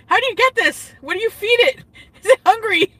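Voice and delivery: put-on high squeaky child asking with wonder; little kid voice. Retelling how he asked his mom as a kid, high child voice. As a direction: chirp it up like a curious toddler.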